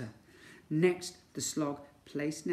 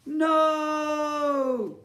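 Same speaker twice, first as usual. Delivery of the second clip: high, loud shout of dismay; alarmed shout; 12 semitones higher, 10 dB louder than usual. Acted. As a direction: cry out in horror. Ned falls into moat.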